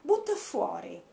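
usual speaking voice

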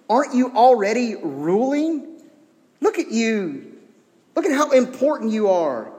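high, gushing mock admiration; sarcastic. Paul mocking self-important Corinthians, sarcastic. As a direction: flatter them with obviously fake awe. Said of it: "being wholly sarcastic".

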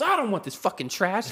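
high-pitched mock disgust; imitated angry refusal. Man refusing food from the lady, imitated high-pitched anger. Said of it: "he was still tripping out".

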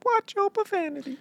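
very high squeaky quote; chirpy cartoon scold. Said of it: "My brain automatically fills in".

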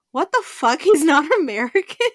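loud, high-pitched imitation of public shock; hammed-up incredulous question; mock disbelief. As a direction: exclaim it, acting floored, with a laugh. Imitating everyone's shock at accent.

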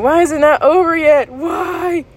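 high, loud wail; acted-out pleading, last word stretched; panicked plea. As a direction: cry out in mock desperation. Requotes own panicked screaming.

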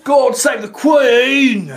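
high, loud, drawn-out shout; hammed-up hollered toast; mock patriotic cheer. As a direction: bellow it as a slow mock salute. Mock royal cheer for a viewer.

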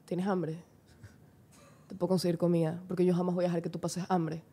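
low, soft, hammed-up seductive pitch; low purring flirt, half joking; mock seductive murmur. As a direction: play the smooth lover offering food.

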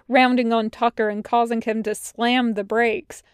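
ordinary delivery

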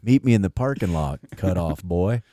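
low, soft sleazy murmur; a creep winking at you; lightly voiced sleaze; creepy come-on.

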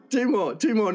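insistent. Real, not acted. Joke teller overriding the listener's refusal, insistent. Haggling over more jokes.

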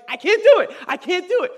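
very high, loud mock-panicked shriek; hammed-up hysterical refusal; imitated freak-out. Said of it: "she starts freaking out".

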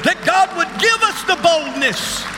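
fired-up preacher rallying the room; roaring rallying speech. Acted.

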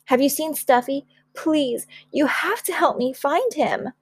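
high, mildly anxious plea; worried pleading. Acted. Child begging mother to find the lost rabbit, worried.

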